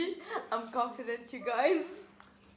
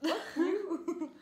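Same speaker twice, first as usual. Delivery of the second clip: laughing; 7 semitones higher than usual. Real, not acted. Friend makes her laugh.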